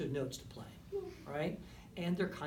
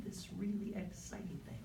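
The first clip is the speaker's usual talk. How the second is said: much softer yet urgent; soft but intense; 4 semitones higher, 6 dB quieter than usual. Shown as a demonstration. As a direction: drop right down but keep energy.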